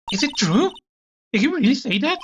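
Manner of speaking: high-pitched incredulous questioning; shocked disbelief. Acted. Game character questions a shocking claim, high and incredulous.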